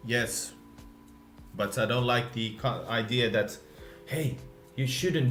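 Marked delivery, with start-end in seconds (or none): none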